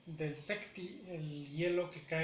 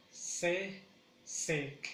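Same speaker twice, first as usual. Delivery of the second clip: "se, sec" as two separate beats; syllable by syllable. Shown as a demonstration. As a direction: pause after se, then a firm sec. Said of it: "en la segunda sílaba".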